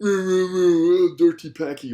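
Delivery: slow, loud laugh through the words. Real, not acted. Laughing while naming the type.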